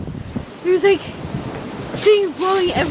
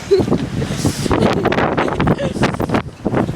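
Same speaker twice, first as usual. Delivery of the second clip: breathy laugh; 3 semitones higher than usual. Real, not acted. Laughs at the dog.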